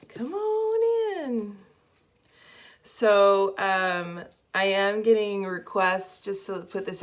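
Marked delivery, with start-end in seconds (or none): 0.1-1.6 high, loud, drawn-out singsong call; calling out welcome. Real, not acted. Host to arriving viewers, high calling welcome. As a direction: sing out a long, high welcome.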